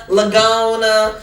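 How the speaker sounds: Lagona with the G and O flipped, slow and high, mocking; mispronounced name. Mocking the army mispronunciation, slow high mock. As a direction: drag out the wrong Lagona. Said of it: "I've heard".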